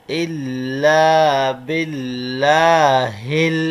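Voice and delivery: slow, drawn-out Arabic recitation, word by word. Shown as a demonstration. Teacher reciting the kalima slowly for students to follow. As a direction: chant each word long for the class to repeat.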